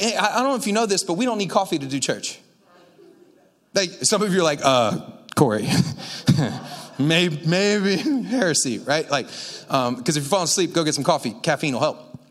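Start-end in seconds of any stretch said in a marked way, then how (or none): 4.6-8.8 hesitant doubting congregant. Pretend. Congregant doubts a line about coffee.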